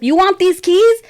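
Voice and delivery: high-pitched, challenging question; acted angry dad. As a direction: imitate a fed-up father's retort. Dad snaps back in retold quarrel.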